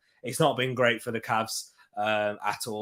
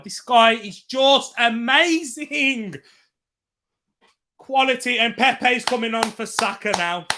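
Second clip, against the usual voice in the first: excited praise; 8 semitones higher, 5 dB louder than usual. Real, not acted. Raving about Odegaard on stream.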